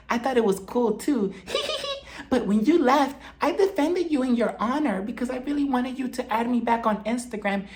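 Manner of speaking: high, soft and sweet put-on voice; sugary Whitney voice. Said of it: "she's like".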